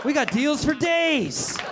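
mock radio announcer; hammed-up ad pitchman. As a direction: sell drunk driving like a cheesy radio ad. Voicing the liquor ad subtext.

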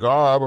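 blustering wordless heckle, imitated; mock grumbling uproar. Host imitating Republicans at the border bill, mock grumbling. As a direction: run on into mumbled jeering.

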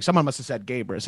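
self-mocking murmur. Mocking his own ego.

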